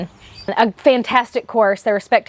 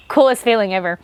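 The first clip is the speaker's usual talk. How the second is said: ordinary delivery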